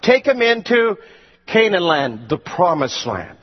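normal talking voice

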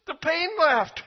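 put-on high, loud, gleeful kid voice; imitating laughing child. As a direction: play a boy who can't stop laughing. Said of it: "he's sitting there laughing".

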